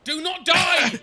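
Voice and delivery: high, loud yell at a dying teammate; urgent shout. Real, not acted. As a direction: shout it to keep him alive. Teammate about to die in game.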